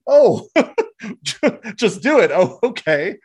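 high, loud, laughing mock epiphany; sarcastic mock realisation. Mocking a leader who says make it happen, sarcastic. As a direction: exclaim it as if suddenly enlightened.

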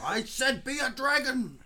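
high, loud copy of the outburst; mock exasperated shout. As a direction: yell it as a hammed-up impression. Co-host copies the shouted line.

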